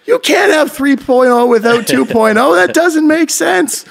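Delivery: high-pitched giggling protest, slowing at the end; laughing disbelief. Retold or imitated. Retelling a stoned laugh at his friend's joke, giggling high and slow. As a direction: squeal it through laughter, half stoned.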